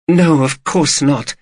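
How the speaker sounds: wife reassuring husband. A narrator's reading.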